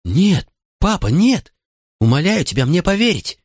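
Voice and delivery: put-on high, desperate child's plea; boy on the verge of tears, voice pitched high; tearful pleading. A narrator's reading. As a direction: beg Dad to believe you. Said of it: "Слёзы брызнули из глаз Николаса".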